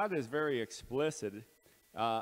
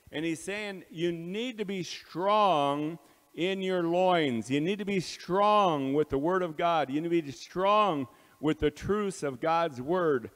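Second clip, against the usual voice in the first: preaching at full force; 4 semitones higher, 7 dB louder than usual. Real, not acted. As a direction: drive it home from the pulpit.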